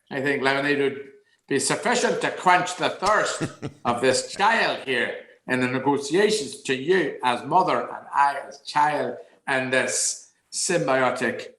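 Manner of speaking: mock-formal child in Northern Irish accent; swooping, over-eloquent Northern Irish delivery. Child negotiating lemonade with mother, Northern Irish accent. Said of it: "And then after six months".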